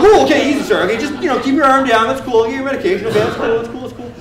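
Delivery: high, loud mock-panicked soothing; alarmed placating. Medic to patient swinging his broken arm, panicky placating.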